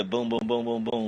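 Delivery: imitated gunshots. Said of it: "I'm gonna say".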